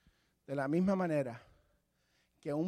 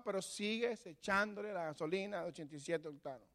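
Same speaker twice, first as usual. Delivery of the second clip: usual speaking voice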